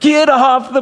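high-pitched imitated yell; retold partner's shout. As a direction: call it out urgently, pitch way up. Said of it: "he shouted in our car".

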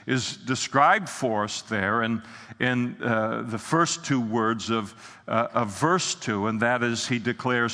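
ordinary delivery

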